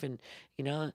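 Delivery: normal talking voice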